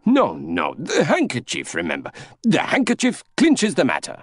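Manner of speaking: high, swooping objection; insistent Monsieur Bouc. Acted. Bouc insisting on the handkerchief evidence, excitable and high. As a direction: insist excitedly, pitch leaping up.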